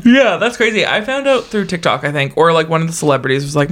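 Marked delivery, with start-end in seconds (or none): none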